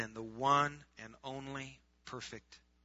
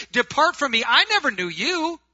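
loud, raised-pitch dismissal; thundering rejection; 12 semitones higher, 13 dB louder than usual. Acted. The Lord turning away churchgoers on judgment day, loud rejection. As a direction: cast them away with a loud, high voice.

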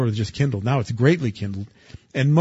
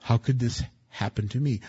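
usual speaking voice

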